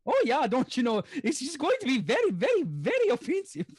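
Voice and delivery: hammed-up bouncing Minnesotan lilt; Minnesotan accent. Shown as a demonstration. Impressionist asked for Minnesotan, exaggerated sing-song Minnesotan accent.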